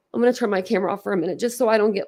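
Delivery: normal talking voice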